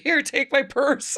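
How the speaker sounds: high, drawn-out cheeky offer; mock-helpful offer. Woman re-enacts offering purse instead of dress, high playful offer. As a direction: pitch it up and stretch it playfully.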